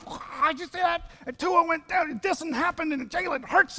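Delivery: high, rushed game recap; excited fan. Acted. Fan at the fifty-yard line gushing about the game, high and excited. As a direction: gush about the play.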